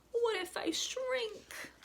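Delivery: high, slow, fretful little voice; worried child. A narrator's reading. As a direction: ask it like a scared little girl. Wemberly's worried question.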